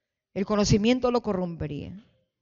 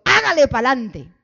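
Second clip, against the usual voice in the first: rousing cheer; 6 semitones higher, 9 dB louder than usual. Acted. Congregation urging the pastor onward, rousing cheer. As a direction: cheer him on with a big raised voice.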